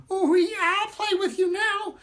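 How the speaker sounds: very high, eager squeak; excited squeak. Acted. Matzo man agreeing to play, high eager squeak. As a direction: bounce up into a tiny voice.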